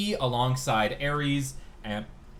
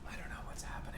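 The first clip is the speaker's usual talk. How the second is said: hushed, confused whisper; 17 dB quieter, faster than usual. Real, not acted. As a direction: whisper it under your breath. Whispered aside.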